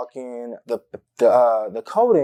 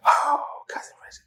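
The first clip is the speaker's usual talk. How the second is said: whispered, much higher, as if dreading her; dramatic whisper. Real, not acted. Telling a friend about cousin Bridget, dramatic whisper. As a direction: breathe her name like a warning. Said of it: "fucking cousin Bridget".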